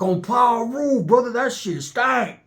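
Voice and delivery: high, slow drawn-out complaint; imitating a Blood. Blood inmate complaining the smell stank. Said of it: "the Bloods was like".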